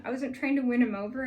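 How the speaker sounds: ordinary talk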